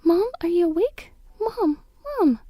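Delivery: high, emotional calls; overjoyed through tears; tearful relieved child. Acted. Daughter at mom's hospital bed, tearful relief.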